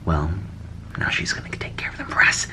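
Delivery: fast conspiratorial whisper. Real, not acted. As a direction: whisper it quickly. Whispered aside.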